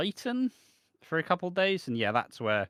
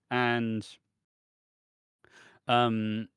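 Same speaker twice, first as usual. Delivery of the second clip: normal talking voice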